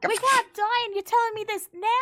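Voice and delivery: high, loud, fast panicked squawk; shocked centaur yelping in disbelief; alarmed disbelief. Acted. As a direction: freak out at the news.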